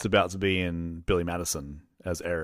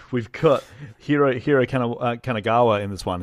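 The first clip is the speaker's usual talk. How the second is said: ordinary talk, nothing marked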